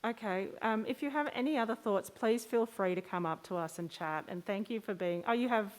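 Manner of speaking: ordinary delivery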